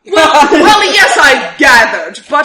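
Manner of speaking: loud, high, drawn-out exasperation; exasperated. Acted. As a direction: stammer "well", then stretch it out loudly. Exasperated reply to vague job offer.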